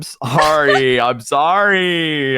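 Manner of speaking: drawn-out playful sorry; mock apology. Streamer apologizing to chat over delays, drawn-out mock plea. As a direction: stretch each sorry in exaggerated apology.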